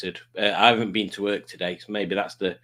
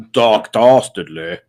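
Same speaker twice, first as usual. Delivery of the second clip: booming villain voice; 2 semitones lower, 8 dB louder, slower than usual. Shown as a demonstration. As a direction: stretch out "Doc Dastardly" loud and slow. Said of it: "or as I like to call him".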